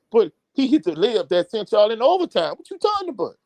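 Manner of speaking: rising in disbelief, arguing his point; heated disbelief. Retold or imitated. Coach arguing with his players over the backup center, rising and indignant. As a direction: pitch climbing high into an indignant question.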